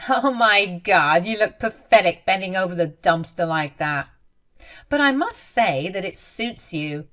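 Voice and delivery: ordinary delivery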